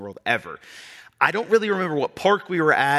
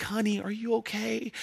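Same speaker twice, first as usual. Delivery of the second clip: softer, higher sweet lady voice; kind Disney lady; 8 semitones higher, 8 dB quieter than usual. Retold or imitated. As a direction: coo gently at a lost child. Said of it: "she's like".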